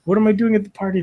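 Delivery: mock disbelief, voice climbing; exasperated. Exasperated outburst, louder higher.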